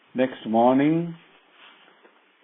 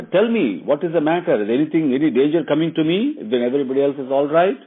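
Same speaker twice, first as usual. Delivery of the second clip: higher, coaxing plea for an answer; pleading; 5 semitones higher, 5 dB louder, faster than usual. Retold or imitated. Queen pleading with her maid, higher and coaxing. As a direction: coax the answer out of her gently. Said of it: "pleaded for an answer".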